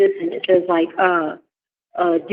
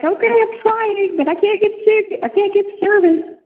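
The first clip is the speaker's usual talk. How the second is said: exasperated whine; 12 semitones higher than usual. Retold or imitated. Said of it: "I was like".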